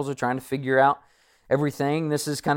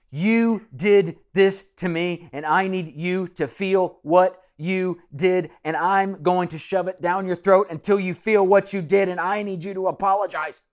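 bitter accusation; 6 semitones higher, 6 dB louder than usual. Pretend. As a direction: shove the blame at them. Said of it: "If it's a bitter person".

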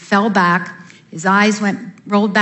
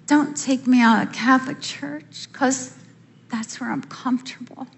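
soft pleading aside; 3 semitones higher, 7 dB quieter than usual. Retold or imitated. Begging God to let her stay.

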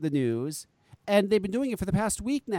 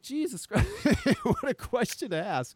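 higher-pitched, breaking into laughter mid-line; laughing disbelief; 7 semitones higher than usual. Real, not acted. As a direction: laugh through the astonished reaction. Retelling, laughing at the question.

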